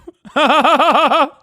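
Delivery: a loud, high burst of real laughter; big laugh. Real, not acted. Streamer bursts out laughing at the ending, loud and high. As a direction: crack up loud at the punchline.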